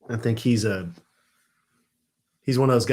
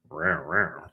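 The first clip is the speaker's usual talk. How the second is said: playful growl; 6 semitones lower, 8 dB quieter than usual. Pretend. Toy figure, playful growl.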